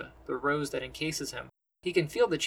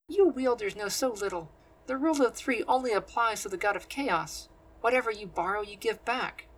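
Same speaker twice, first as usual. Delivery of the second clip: higher-pitched, superior sorceress lecturing; condescending woman's voice; 6 semitones higher than usual. A narrator's reading. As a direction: talk down to him like a know-it-all. Teresa lectures Leon dismissively.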